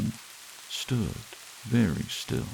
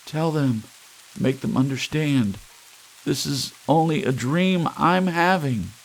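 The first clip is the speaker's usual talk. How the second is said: high, pleading and louder; desperate plea; 8 semitones higher, 4 dB louder than usual. Acted. Creature pleading with the largest one, desperate and high. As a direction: beg them to understand before the punishment. Creature begs before its punishment.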